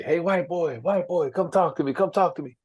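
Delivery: mentor calling down the hall. Retold or imitated. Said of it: "He would call over every day".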